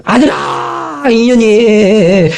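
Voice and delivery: drawn-out, high, crying howl to her son; crying shout. Retold or imitated. Mother-in-law howling for her son against her daughter-in-law.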